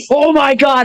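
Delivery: high, thrilled oh my God; excited gasp. Retold or imitated. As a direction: burst out high and amazed. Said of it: "Frank fucking went".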